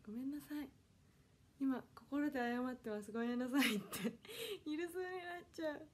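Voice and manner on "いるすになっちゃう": laughing it off, a bit higher; amused laughing repeat. Real, not acted. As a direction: giggle through the worry. Laughs at her own panic.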